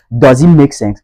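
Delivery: DOES as "dawz" in a Nigerian accent. Shown as a demonstration. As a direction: round the vowel of does to "aw", Nigerian style. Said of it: "Ça, c'est du côté nigérian".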